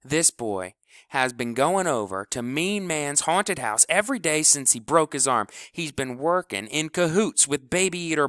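loud, high furious rant. Acted. Kleebo rants at the team about House, loud and furious. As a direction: rail at the whole team. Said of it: "Kleebo was a geyser".

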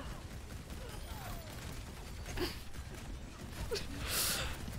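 airy unvoiced giggle; breathy high giggle. Real, not acted. Breathy laugh at own joke.

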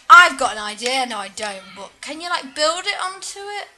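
ordinary delivery

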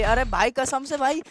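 high, loud, insistent brag; excited boast. Real, not acted. Gamer bragging to squad about three kills, excited. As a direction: swear to it at the top of your pitch.

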